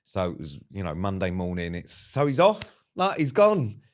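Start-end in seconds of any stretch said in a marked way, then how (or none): 1.9-3.8 high excited announcement. Retold or imitated. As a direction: voice shoots up with glee. Retold excited update.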